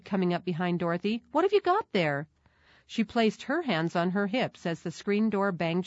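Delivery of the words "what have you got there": high, sharp motherly questioning; stern mother's high-pitched question; stern echo. A narrator's reading. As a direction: demand an answer in a much higher pitch. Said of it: "his mother echoed".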